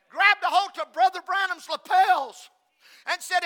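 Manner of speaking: loud, emphatic preacher's proclamation; full-force preaching. Acted.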